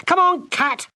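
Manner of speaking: high shouted call; girl shouting to Kat as she runs off. Acted. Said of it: "Gwendolen shouted at him".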